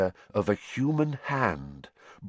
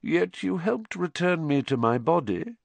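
coolly; 7 semitones higher than usual. A narrator's reading. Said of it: "said Voldemort coolly".

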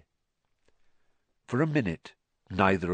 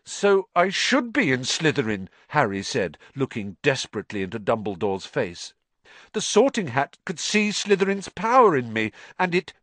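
put-on higher, urgent boy's voice; pleading for an answer; boy begging the headmaster to explain; desperate young Harry; 6 semitones higher, 3 dB louder than usual. A narrator's reading.

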